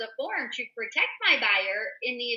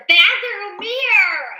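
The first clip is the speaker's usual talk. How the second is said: laughing through the words, pitched up and louder; 8 semitones higher, 7 dB louder, slower than usual. Real, not acted. Telling colleagues about a seller who took the mirror, laughing. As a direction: let a laugh break into the last words.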